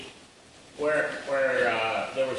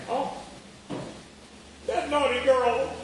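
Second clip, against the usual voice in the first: high, slow mock-coy woman's voice; a hammed-up purr of naughty girl; her teasing voice; 12 semitones higher, slower than usual. Mimicking the woman stroking his hair, high and slow.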